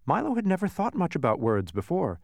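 plain reading voice

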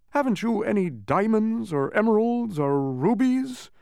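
high, slow, whiny-irritable demand. A narrator's reading. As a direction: complain in a high, drawn-out voice. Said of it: "asked the bug irritably".

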